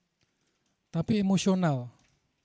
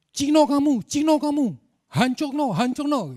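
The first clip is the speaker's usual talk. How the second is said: much higher, loud hostile shouting; angry mob shouting; 9 semitones higher, 7 dB louder than usual. Retold or imitated. Street crowd jeering at the young driver, furious shouting. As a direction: yell it like a furious crowd closing in. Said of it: "teriak-teriaknya udah, udah parah gitu".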